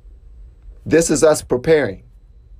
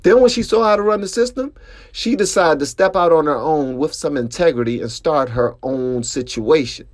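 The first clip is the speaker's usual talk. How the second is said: ordinary delivery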